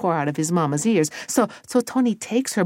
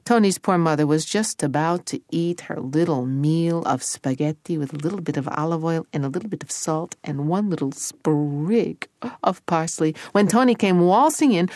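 usual speaking voice

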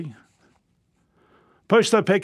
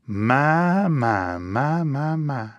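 a slow, drawn-out "my, my" that sinks lower and fades; admiring my my my; 3 semitones lower, much slower than usual. Shown as a demonstration. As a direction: lay on the admiration, falling away gently. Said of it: "learn the magic phrase".

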